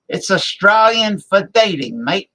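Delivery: hammed-up Australian accent slogan like a beer commercial. Streamer riffs on the Foster's beer ad in a hammed-up Australian accent. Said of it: "the old Foster's beer commercial".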